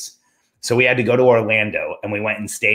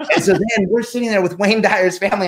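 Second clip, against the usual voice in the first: higher, laughing while telling it; laughing disbelief; 9 semitones higher than usual. Real, not acted. Telling a friend about sitting with the family, laughing. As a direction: let the laugh through the words.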